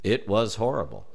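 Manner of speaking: ordinary delivery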